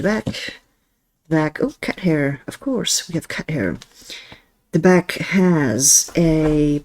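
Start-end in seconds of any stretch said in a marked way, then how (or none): none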